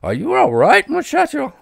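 worried. Acted. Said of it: "She was worried".

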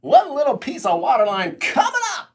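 high, loud DJ announcement; radio voice. Pretend. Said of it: "[name] says radio voice".

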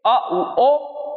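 each vowel drawn out slowly, one by one; slow recital of vowels. Shown as a demonstration. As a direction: spell out अ, उ, ओ very slowly like a teacher. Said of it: "तीन चीजें बनाएंगे".

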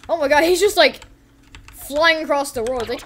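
ordinary delivery

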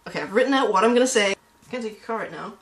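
ordinary talk

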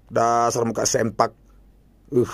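ordinary talk, nothing marked

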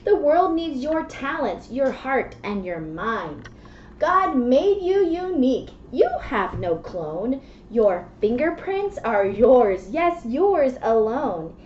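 usual speaking voice

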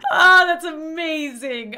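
loud, slow, drawn-out laughing exclamation; laughing delight. Real, not acted. Player delighted by the ejection, laughing loudly. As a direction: let laughter carry through the words.

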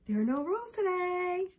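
put-on high child's teasing chant; sing-song taunt. A narrator's reading. David reminds Michael there are no rules, sing-song taunt. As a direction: taunt him, holding the last word.

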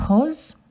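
rose as rohz, rising; French pronunciation. Shown as a demonstration. Asking children to repeat rose. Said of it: "Can you say".

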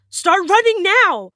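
high, loud, drawn-out warning shout; urgent yell. Retold or imitated. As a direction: yell to friends to flee, stretching the words. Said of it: "I yell".

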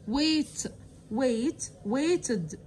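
normal talking voice

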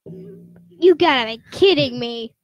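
slow, loud exasperation; retold disbelief. Player recounting finding everything unplugged, exasperated. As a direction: act out drawn-out disbelief. Retelling reaction to unplugged computer.

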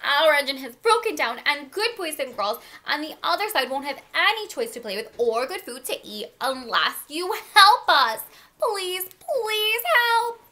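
high, urgent storybook plea; pleading cry. A narrator's reading. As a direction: plead for help, stretching the last words. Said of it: "cried the dolls and toys".

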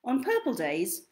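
lively storybook read with big swings; reading to children. Acted. Reads picture book aloud.

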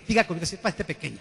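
ordinary talk, nothing marked

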